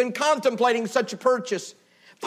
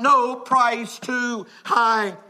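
solemn; much slower than usual. Acted. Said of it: "my Savior cried out".

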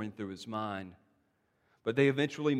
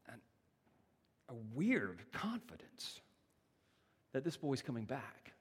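hushed whisper; 9 dB quieter, slower than usual. Acted. Awed hush over Abraham's confidence.

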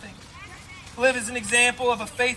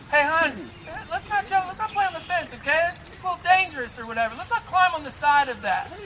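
coaxing mom; 6 semitones higher than usual. Acted. Mom warning her kid off the fence, high gentle coaxing. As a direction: imitate a mom asking nicely, pitched up. Voicing mom repeating herself.

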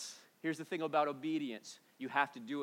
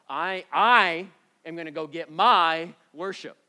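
mock entitlement; 3 semitones higher, 14 dB louder than usual. Mocking self-centred worshippers.

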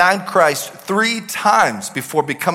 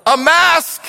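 much higher, loud rant over clanging drums; 10 semitones higher, 3 dB louder than usual. Acted. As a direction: yell it like a clanging cymbal. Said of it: "This is what this sounds like to everyone else".

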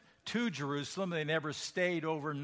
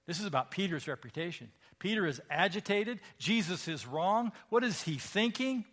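ordinary delivery

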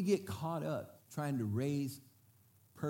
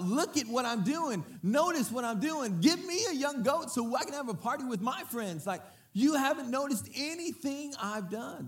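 put-on demanding older brother; 10 semitones higher than usual.

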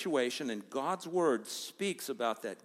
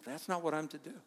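ordinary delivery

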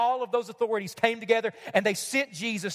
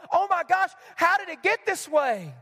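mock fretting God; 3 semitones higher than usual. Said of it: "Surely God is pacing the halls of heaven".

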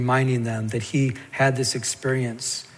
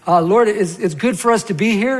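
higher, louder voice for a blurting Peter; flustered apostle; 8 semitones higher, 7 dB louder than usual. Acted. Preacher mimics Peter not knowing what to say, high flustered blurting. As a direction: play an eager apostle piping up high.

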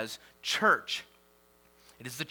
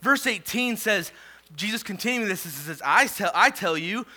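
louder and higher scripture proclamation; preaching at full force; 7 semitones higher, 6 dB louder than usual. Acted. Pastor reading Jesus's words to Peter, preaching at full force. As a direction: declare the verse boldly.

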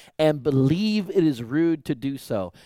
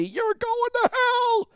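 high, slow mock preacher condemning; Bible thumper; slower than usual. Mocking the Bible thumpers who preached at him. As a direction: squeal it like a smug street preacher. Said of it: "those Bible thumpers coming up to me".

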